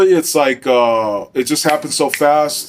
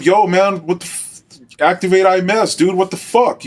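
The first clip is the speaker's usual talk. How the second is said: higher-pitched, urgent yell at reduced volume; retold yell; 7 semitones higher than usual. Officer yelling at a watching coworker to radio for help, retold yell. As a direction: re-enact yelling at a coworker without really shouting. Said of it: "so I yelled at him".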